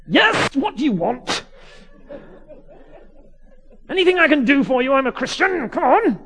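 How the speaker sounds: high, loud Fawlty Towers exasperation; John Cleese. Retold or imitated. Exasperated vicar to the pestering kid, irate Fawlty Towers manner. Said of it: "sound more and more like John Cleese in Fawlty Towers".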